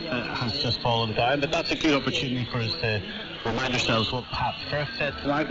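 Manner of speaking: usual speaking voice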